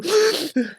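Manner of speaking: high mock sob gasp, a baby crying itself out; imitated sobbing gasp. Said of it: "They're sobbing themselves to".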